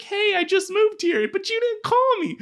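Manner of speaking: squeaky-high imitation of a client; high-pitched mock complaint. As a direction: voice shoots way up in playful protest. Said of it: "My voice just went high there".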